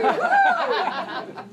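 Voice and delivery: high, loud whoop; laughing whoop. Real, not acted. Speaker recovering from losing her thread, laughing whoop. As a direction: whoop through real laughter. Breaks up laughing mid-talk.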